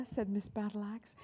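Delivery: plain reading voice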